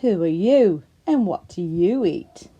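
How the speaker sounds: loud taunting question; loud crocodile question. Acted. Crocodile challenging the frog loudly. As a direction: throw it back at him loudly.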